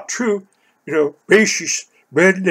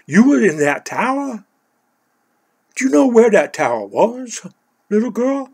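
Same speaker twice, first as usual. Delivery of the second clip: higher-pitched, incredulous questioning; retold astonishment; 6 semitones higher, 4 dB louder than usual. Uncle learns niece was in that tower, retold astonishment. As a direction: question it in raised-pitch disbelief.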